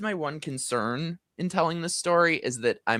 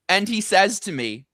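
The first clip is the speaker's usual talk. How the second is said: ordinary delivery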